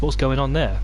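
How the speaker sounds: usual speaking voice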